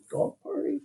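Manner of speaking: "Dog party?" repeated high and soft as a question; amused echo. Shown as a demonstration. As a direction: savor the little girl's idea. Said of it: "You hear that?".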